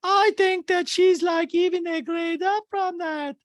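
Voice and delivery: very high, slow, droning voice; exaggerated squeaky castmate impression; Diana. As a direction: drag it out in a piercing high pitch. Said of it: "And Diana going".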